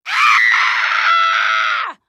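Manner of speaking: sharp, loud yell of frustration; sudden scream. Acted. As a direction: burst out with a scream.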